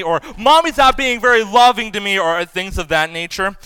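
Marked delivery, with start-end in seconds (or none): 0.4-2.2 mock childish complaint; complaining child. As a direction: imitate a kid's grievance. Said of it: "have said a few times".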